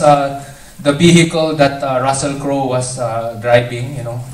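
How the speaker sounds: put-on casual Filipino-accented English; stand-up storytelling in a Filipino accent. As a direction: talk like Manila hotel staff, in a Filipino accent. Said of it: "they talk with this kind of accent".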